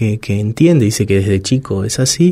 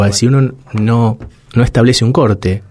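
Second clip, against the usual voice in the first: normal talking voice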